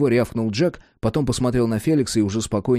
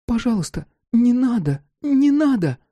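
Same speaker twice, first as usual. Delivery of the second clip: put-on high, frightened child's voice near tears; tearful little girl voice; 13 semitones higher, 3 dB louder than usual. A narrator's reading. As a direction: plead like a scared little child. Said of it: "кукольным голоском сквозь подступающие слёзы".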